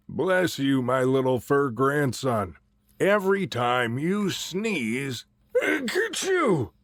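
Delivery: a hint of a kindly old grandpa. Grandpa blesses his sneezing grandson, lightly voiced old man. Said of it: "and walked out of his hollow stump and said".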